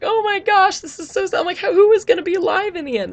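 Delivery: high, loud half-crying wail; mock distraught. Vlogger reliving the character deaths, mock tearful high wail. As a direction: play up tearful movie shock. Retelling her reaction to deaths.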